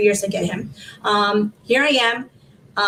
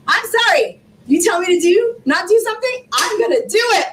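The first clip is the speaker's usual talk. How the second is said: defiant; 7 semitones higher than usual. Real, not acted. Defiant at people who doubt her.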